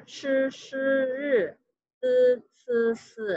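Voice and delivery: each initial read out slowly, clearly and distinctly; teacher chanting the consonant list; initials drill. Shown as a demonstration. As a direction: drill the Mandarin initials one by one. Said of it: "kita ulang kembali".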